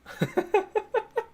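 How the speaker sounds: very high giddy giggle; giddy laugh. Real, not acted. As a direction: let out a delighted giggle. Delighted laugh at chat.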